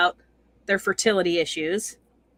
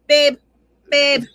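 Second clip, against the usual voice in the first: imitating the wife; 4 semitones higher, 6 dB louder than usual. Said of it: "she calls him babe all the time".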